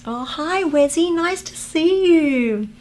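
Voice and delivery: squeaky high hello to a fan; sing-song high welcome to a viewer; high cute greeting. Real, not acted. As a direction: greet them in a high, sweet, slow voice.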